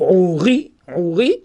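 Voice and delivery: "Ouri" with a weighted, rising "ri"; stress on the second syllable. Shown as a demonstration. Teacher explains second-syllable accent, "Ouri" stressed on "ri". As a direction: hit the "ri" of "Ouri". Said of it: "l'accent tonique est mis sur la deuxième syllabe".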